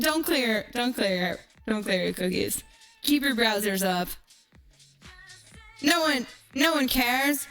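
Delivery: ordinary talk, nothing marked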